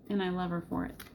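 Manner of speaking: ordinary talk, nothing marked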